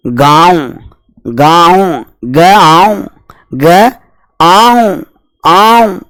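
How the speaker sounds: गांव with a long nasal आ; nasal vowel demo. Shown as a demonstration. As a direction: stretch गांव slowly, letting the आ ring through nose and mouth. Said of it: "ये देखो लिखा अपन ने गांव". (गांव को धीरे खींचकर नाक और मुँह से बोलना)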